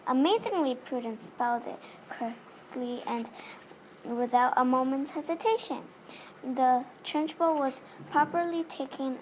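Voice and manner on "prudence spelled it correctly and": ordinary delivery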